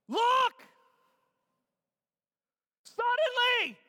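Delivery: high, loud shouts; yelled exclamations. Acted. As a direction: yell each word separately. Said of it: "exclamation point after every one".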